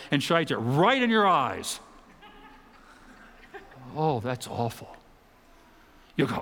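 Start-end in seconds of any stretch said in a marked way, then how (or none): none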